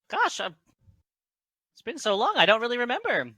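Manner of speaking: high halfling voice, hazily recalling; Garth. Acted. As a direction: pitch it up high, unsure of the memory. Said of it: "that's what Garth says".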